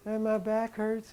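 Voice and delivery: imitating little woman. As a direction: play a meek little patient with aches. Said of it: "And she".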